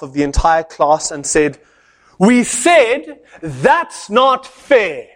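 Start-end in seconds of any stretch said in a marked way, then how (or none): 2.2-5.1 very slow, higher, pointed defiance; student angrily defying the lecturer; angry voice. Retold or imitated. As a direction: spit it back at the teacher word by word. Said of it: "in an angry voice".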